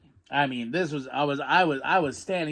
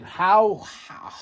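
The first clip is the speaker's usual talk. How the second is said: stunned disbelief; 3 semitones higher, 5 dB louder than usual. Retold or imitated. Stunned by new tag partner.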